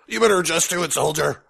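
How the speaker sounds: lightly barked drill sergeant order; a half-hearted drill instructor bark; mock drill sergeant to a recruit; drill sergeant impression. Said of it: "I can't do that impersonation either".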